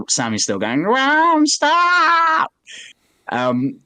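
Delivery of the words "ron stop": very high, drawn-out mock screech; exaggerated wailing yell; imitated scream. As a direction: screech the words out long and high. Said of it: "are still fucking screaming at each other".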